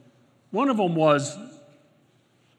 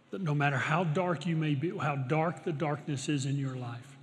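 soft, lowered voice, settling lower at the end; hushed, earnest preaching; 3 semitones lower, 7 dB quieter than usual. Real, not acted. Preacher telling listeners their light is coming, hushed earnest preaching. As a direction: bring it down to a quiet, sincere assurance.

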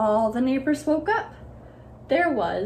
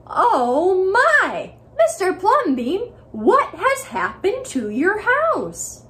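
higher, louder scandalized cry; outraged neighbors; 8 semitones higher, 6 dB louder, slower than usual. Acted. Neighbors see the alligator and palm trees, outraged. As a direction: shriek it in shock. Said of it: "they said".

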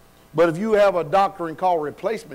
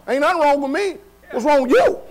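higher-pitched challenge thrown back; indignant retort; 6 semitones higher, 3 dB louder than usual. Acted. Preacher answers those who doubt him, indignant retort. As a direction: fire the question back, pitched up.

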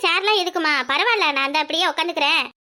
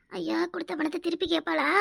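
worried aside; 9 dB quieter than usual. Acted. Anxious worried aside to herself.

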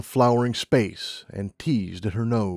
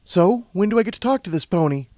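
higher, impatient question; lightly acted eagerness; lightly voiced Lumina; 8 semitones higher, 3 dB louder than usual. A narrator's reading. Lumina asking to meet the pony, impatient.

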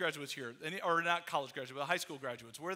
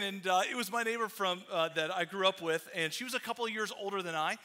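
ordinary delivery

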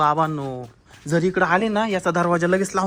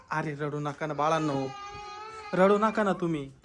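low, soft comforting; soothing; 5 semitones lower, 9 dB quieter than usual. Acted. Man comforts crying children hiding from witch, soothing. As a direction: hush the little ones gently.

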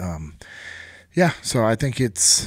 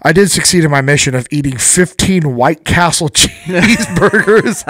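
higher, louder punchline broken by laughter; laughing retold boast; 6 semitones higher, 9 dB louder than usual. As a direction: crack up while quoting a buddy's mock boast.

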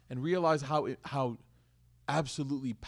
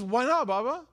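put-on high, innocent child's voice; questioning kid; 8 semitones higher than usual. Four-year-old son asking his dad, voiced high and innocent. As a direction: imitate a puzzled little boy. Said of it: "He goes".

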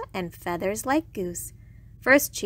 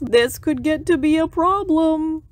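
louder, higher, put-on walrus voice; worried walrus; 6 semitones higher, 5 dB louder than usual. A narrator's reading. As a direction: fret aloud as a walrus. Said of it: "worried Walrus".

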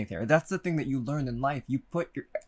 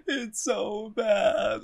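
crying; 7 semitones higher, much slower than usual. Real, not acted. Repeated in tears.

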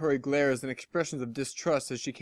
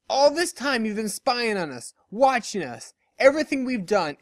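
higher, louder, jabbing each phrase; angry accusation; 5 semitones higher, 3 dB louder than usual. Acted. Dash throwing the spy out of town, angrily jostling her. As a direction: shove every sentence at her.

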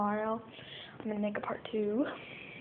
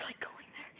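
whispering; 16 dB quieter than usual. Real, not acted. Quiet commentary on dog.

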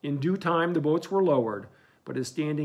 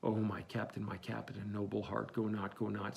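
1 tearful plea; 4 semitones lower, 9 dB quieter than usual. A narrator's reading. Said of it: "Starbuck's tears the glue".

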